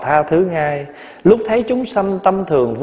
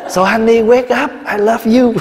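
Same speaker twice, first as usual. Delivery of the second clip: wife pleading over husband's sickbed; remorseful wife; 8 semitones higher, 3 dB louder than usual. Retold or imitated. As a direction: beg a stricken husband to wake. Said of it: "Bà nói".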